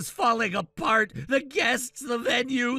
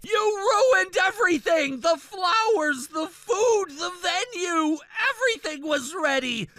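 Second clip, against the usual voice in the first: angry rant; 2 semitones higher than usual. Acted. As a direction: vent his ruined wedding, near tears. Jilted groom ranting at sister.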